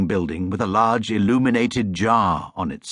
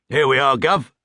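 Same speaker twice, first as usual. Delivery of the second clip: cabbie; 4 semitones higher, 4 dB louder than usual. Acted. Cabbie voiced in story.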